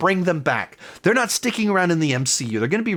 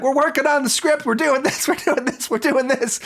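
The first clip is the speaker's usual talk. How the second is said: high, breezy mock producer spin, much higher; high-pitched mocking impression of a studio boss dodging press; imitating Kevin Feige; 10 semitones higher than usual. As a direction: lightly parody an exec brushing off questions. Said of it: "Kevin Feige's like".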